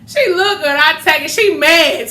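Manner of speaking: high, loud gleeful gloating. Retold or imitated. Daughter gloating about getting dad's money, high and gleeful. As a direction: relish every word.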